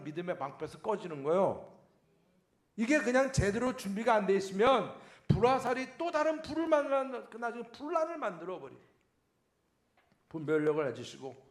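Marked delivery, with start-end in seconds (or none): 2.7-8.8 raised, fervent preacher voice; preaching. Acted. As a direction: preach it higher and louder. Preaching at full force.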